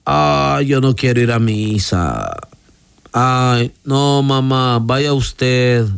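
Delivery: drawn-out, reluctant groaning complaint; lazy whining. Acted. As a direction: act out someone too lazy to get up. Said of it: "¿Será la pereza?". (quejido perezoso)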